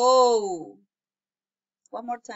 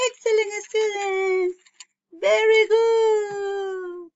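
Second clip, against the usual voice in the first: high, slow, sing-song cheering for the class; gushing praise; 9 semitones higher, 5 dB louder, slower than usual. Acted. Teacher celebrating her little students, high and drawn-out. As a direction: stretch each word out like applause.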